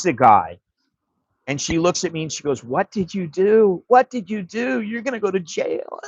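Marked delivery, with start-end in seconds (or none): 2.6-6.0 imitating a freaked-out wife, high-pitched wail. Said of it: "She's all freaked out".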